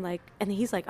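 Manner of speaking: normal talking voice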